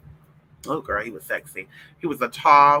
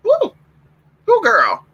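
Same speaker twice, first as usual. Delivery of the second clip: ordinary delivery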